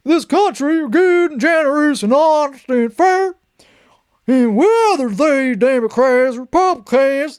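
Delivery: high, pushed caricature of a speechmaker; exaggerated high mock voice; mocking impersonation. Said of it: "I gotta read in his voice".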